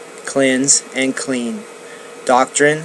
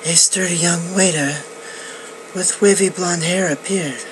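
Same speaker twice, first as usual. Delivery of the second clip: lightly lifted storytelling voice, a touch quicker; story narration; 5 semitones higher, 5 dB quieter, faster than usual. A narrator's reading. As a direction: read it like a novel to a listener.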